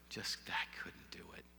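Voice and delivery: whispered, barely holding back grief; broken whisper. Retold or imitated. Retelling his grief, whispering that he couldn't go on. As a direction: let it fall to a breath, overwhelmed. Breaking down at the reception.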